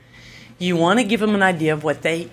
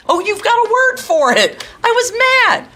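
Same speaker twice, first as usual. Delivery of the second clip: put-on much higher, louder delighted child voice; child discovering a feeling; 4 dB louder than usual. Shown as a demonstration. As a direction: squeal it like a kid proud of the word. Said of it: "teacher voices child realizing".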